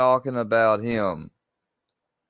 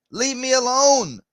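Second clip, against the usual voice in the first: exasperated demand; 12 semitones higher, 3 dB louder than usual. Real, not acted. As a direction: insist with real exasperation, voice way up high. Exasperated demand to be left alone.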